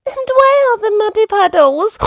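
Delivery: very high piglet voice; Peppa. A narrator's reading. Child asking about puddles, very high piglet voice. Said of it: "asked Peppa".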